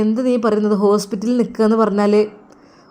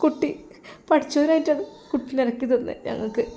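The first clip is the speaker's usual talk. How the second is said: tearful mother; 2 semitones higher, 5 dB quieter than usual. Acted. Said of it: "ഉമ്മ, നിങ്ങൾ കരയല്ലേ".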